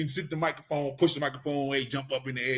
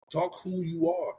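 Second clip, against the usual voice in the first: normal talking voice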